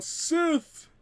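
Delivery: high, loud call across the field; yelling. Acted. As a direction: summon her. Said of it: "Thor yells out".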